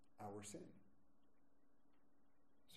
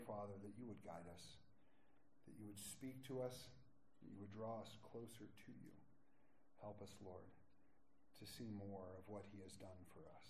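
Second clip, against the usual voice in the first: prayer; 6 semitones lower, 5 dB quieter than usual. Acted. Pastor leading the church in prayer, hushed and reverent. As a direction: pray quietly and reverently.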